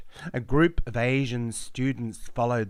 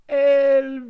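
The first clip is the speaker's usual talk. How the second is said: loud, much higher call across a distance; calling name; 12 dB louder than usual. Acted. Foreign voices calling the narrator, a loud high cry. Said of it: "voices called my name in Italian".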